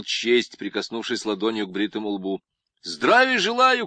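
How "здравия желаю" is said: much higher, louder, slow military shout; loud drawn-out soldier's greeting; shouted salute. Acted. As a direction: bark the salute to the chief. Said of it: "крикнул он".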